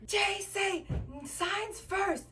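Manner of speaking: high, insistent mock shout; imitating a yelling man. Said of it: "don't yell at me".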